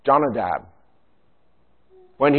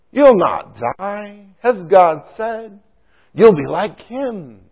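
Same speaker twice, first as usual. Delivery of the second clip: high, slow and sly serpent voice, much louder; Satan tempting Eve; 9 semitones higher, 6 dB louder, slower than usual. Retold or imitated. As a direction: draw it out like a tempter luring Eve. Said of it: "He professed to be telling Eve the truth".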